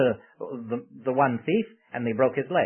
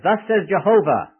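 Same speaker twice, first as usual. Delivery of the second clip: loud, high-pitched declaration; prophetic proclamation; 7 semitones higher, 7 dB louder than usual. Acted. Moses delivering God's word to Pharaoh, loud proclamation. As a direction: proclaim it boldly to a ruler.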